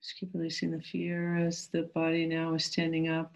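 calm, gentle meditation guide. Acted.

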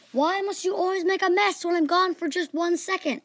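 high, loud, exasperated teacher voice; angry teacher. A narrator's reading. Teacher scolds kids who flooded the basement, high and angry. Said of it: "The teacher then responded angrily".

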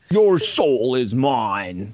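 slow, deep villain line; cold hissing layered whisper. Pretend. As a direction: drag it out like a game villain. Quoting the game villain line.

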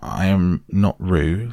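ordinary delivery

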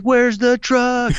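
high, drawn-out mock yell; stretched, pleading shout; wailed question. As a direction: holler it back in desperate disbelief. Second take, wailed back at Ranger.

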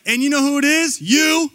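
loud, high finger-pointing blame; acted accusation. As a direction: build to an accusing, high-pitched YOU. Acted accusation against Jesus.

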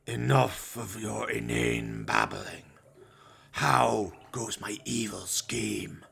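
slow, whispered sinister master; menacing villain. Acted. Master to his henchman, slow menacing whisper. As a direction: hiss it out like a dark overlord, slowly. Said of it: "something horrible and menacing about the figure".

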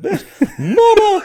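high, slow squealed ad lib; laughing ad lib imitation; mock ad lib. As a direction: stretch it out like a rapper's ad lib. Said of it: "Dave just said".

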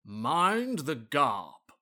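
slow, grand "Mind the Garp", with an R; Garp for gap. Acted. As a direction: stretch "Garp" out, rhyming with harp. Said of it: "it sounded like you put an R in there".

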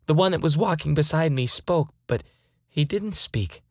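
usual speaking voice

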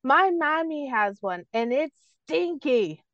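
put-on slow, deliberate kid voice. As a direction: draw it out innocently. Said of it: "And she goes".

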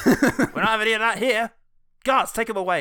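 loud, high indignant command; pompous editor shout. Acted. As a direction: bellow at the guards. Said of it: "And the guards come in".

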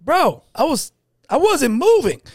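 high-pitched protest; arguing. Retold or imitated. Teller replays arguing with the husband, high protest. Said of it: "arguing with him, I go".

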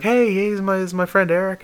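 high-pitched imitation of a friend; friendly introduction. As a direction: introduce someone in a high, casual retold voice.

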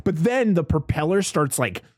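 normal talking voice

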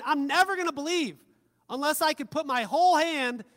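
loud, high, insistent doubter; emphatic refusal. Acted. Acting out Thomas's stubborn refusal.

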